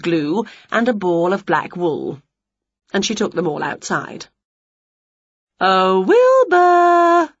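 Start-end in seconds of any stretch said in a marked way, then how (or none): 5.6-7.3 high, slow sing-song call. A narrator's reading. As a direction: call sweetly across the garden. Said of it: "she called".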